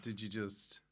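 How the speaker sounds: ordinary talk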